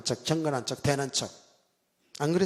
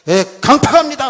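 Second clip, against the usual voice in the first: higher, louder rebuke of a guest preacher; mock scolding shout; 6 semitones higher, 10 dB louder than usual. Guest pastor scolding the unfallen preacher, loud and higher. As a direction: bark the verdict like a stern revivalist. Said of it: "이 강사 목사님 뭐라 그래?".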